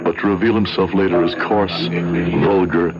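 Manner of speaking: low, measured storytelling voice; story narration. A narrator's reading. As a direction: look back and tell it like a memoir. Narrator recounts the past.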